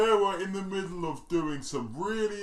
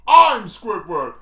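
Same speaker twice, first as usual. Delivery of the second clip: loud slow insistence; 4 semitones higher, 14 dB louder, much slower than usual. Acted. Mock Squidward role.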